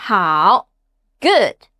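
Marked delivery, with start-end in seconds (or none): 0.1-0.7 "Hao," dipping low, then rising; low falling-rising "Hao,"; third tone. Shown as a demonstration. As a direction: sink low in "Hao," and curve back up. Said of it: "common words with the third tone".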